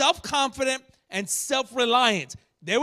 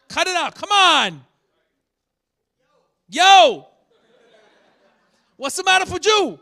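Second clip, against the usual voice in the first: high, loud scolding shouts; confrontational rebuke; 6 semitones higher, 11 dB louder than usual. Shown as a demonstration. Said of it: "This was that confrontation".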